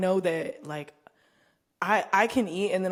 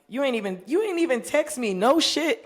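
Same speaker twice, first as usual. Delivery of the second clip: indignant; 7 semitones higher, 4 dB louder than usual. Real, not acted. Indignant complaint.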